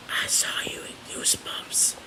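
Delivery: whispered secret. Retold or imitated. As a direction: breathe it like a confession. Secret confession to Hayden Christensen.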